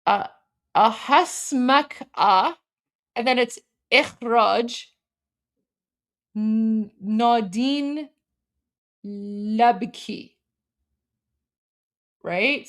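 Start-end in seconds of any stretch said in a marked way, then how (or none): 0.8-10.3 Ahasmak A, Ikhraj, Nadine Labki, slow and halting; sounded out. Shown as a demonstration. Streamer reading Arabic letters she cannot understand, sounded out. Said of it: "I can read this".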